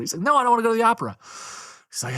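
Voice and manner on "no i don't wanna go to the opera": high, whiny refusal; hammed-up stubborn husband whine. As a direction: dig in harder like a sulking husband. Husband refusing again, escalating.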